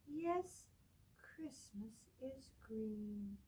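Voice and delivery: plain reading voice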